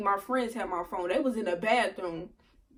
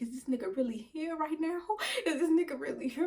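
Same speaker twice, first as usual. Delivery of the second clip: higher, hushed panicked question; stunned disbelief; 5 semitones higher, 4 dB quieter than usual. Retold or imitated. Girlfriend caught on another man's lap, stunned disbelief. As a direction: ask it softly in rising disbelief. Thinking in shock at boyfriend appearing.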